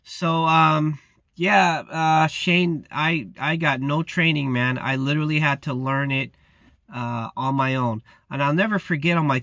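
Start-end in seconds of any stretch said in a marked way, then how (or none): none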